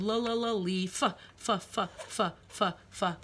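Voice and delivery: "La, la, la" then "leaf", then "F, f" hissed and fading; sounding out leaf. Shown as a demonstration. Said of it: "the vowel we hear in the middle".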